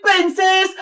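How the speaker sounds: ordinary delivery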